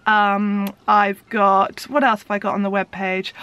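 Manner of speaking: ordinary talk, nothing marked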